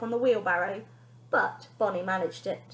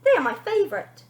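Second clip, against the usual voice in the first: lightly voiced boy; 6 semitones higher, 8 dB louder than usual. Benji voice.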